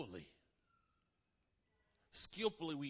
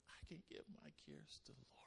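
much softer, hushed whisper; 14 dB quieter than usual. Acted. Preacher whispering the believer's prayer. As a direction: breathe it like a secret prayer.

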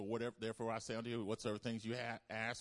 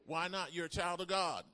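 high, loud preacher's proclamation; preaching at full force, higher, louder, slowing at the end; fiery preaching; 9 semitones higher, 6 dB louder, slower than usual. Acted. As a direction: proclaim it with fire. Preaching peak.

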